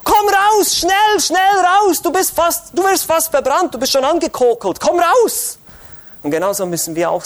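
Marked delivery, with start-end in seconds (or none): 0.0-5.6 high, loud urgent calling out; preacher calling a person out of a burning house, urgently shouting; urgent rescue shout. Pretend. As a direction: yell at someone to escape a burning house. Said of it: "du würdest sagen".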